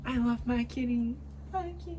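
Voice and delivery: pet-talk voice. Real, not acted. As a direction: coo lovingly in a high little voice. Cooing to her cat.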